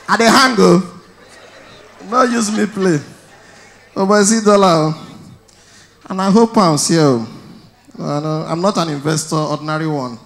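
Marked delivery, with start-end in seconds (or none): none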